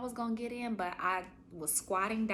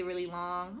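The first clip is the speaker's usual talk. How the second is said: ordinary talk, nothing marked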